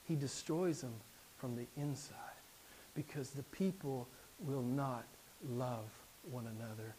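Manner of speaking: preacher warning the church quietly and solemnly; hushed sermon warning. Acted. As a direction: drop the voice to draw them in.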